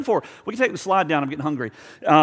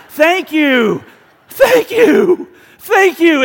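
high, loud, effusive thank yous; over-the-top grateful thank you; gushing thanks; 10 semitones higher, 9 dB louder, much slower than usual. Pretend. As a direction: gush with overflowing gratitude.

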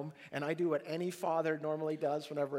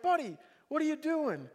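puzzled father; 10 semitones higher, 3 dB louder than usual. Retold or imitated. Father finds son under table.

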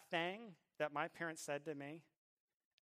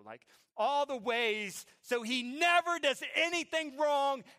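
louder, higher, mock-scheming outburst; worked-up vow to punish; 6 semitones higher, 11 dB louder than usual. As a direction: retell a fuming dad's rant with force. Vows to punish his son.

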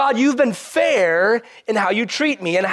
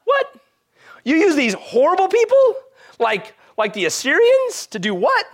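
higher, louder mock outrage at God; incredulous disbelief; 10 semitones higher, 3 dB louder than usual. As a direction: voice shooting up in disbelief, "You use these horrible people?". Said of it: "and you're like".

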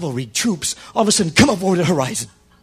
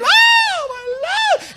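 very high Southern lady shriek; hammed-up Southern damsel wailing; southern accent; 4 dB louder, much slower than usual. Lady chased in the wagon, mock-panicked Southern wail. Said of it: "That's my southern accent".

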